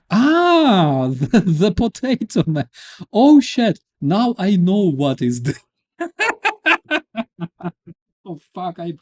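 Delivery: high, loud words bursting through laughter; can't stop laughing mid-sentence; real helpless laughing fit; hysterical laughter. Real, not acted. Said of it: "I'm hysterical".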